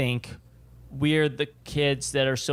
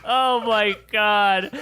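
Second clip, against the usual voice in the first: drawn-out, slow, laughing oh my God; cracking up at the joke; laughing disbelief; 7 semitones higher, 6 dB louder, much slower than usual. Real, not acted. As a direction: let the laugh stretch the words out.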